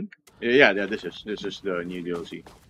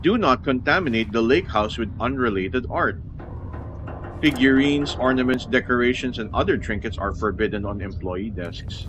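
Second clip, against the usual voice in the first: reading aloud; 4 semitones higher, 4 dB louder than usual. Acted. Reads the in-game memo aloud.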